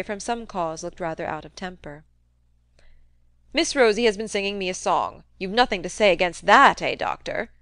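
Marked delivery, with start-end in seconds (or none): 3.5-7.5 put-on old man showing off to his doctor; old Featherstone. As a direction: challenge the doctor in an old man's voice. Narrator voices old Featherstone.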